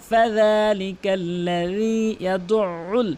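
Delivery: "ইয়াদুল" with a pressed, doubled ayn sound; elongated recitation. Shown as a demonstration. Teacher demonstrates one-alif stretch, slow chant. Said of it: "এক আলিফ টেনে পড়তে হবে".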